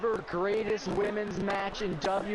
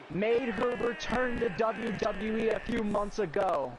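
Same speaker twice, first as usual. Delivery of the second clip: higher, upbeat commentator intro; wrestling commentary; 4 semitones higher than usual. Acted. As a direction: introduce her like a broadcaster.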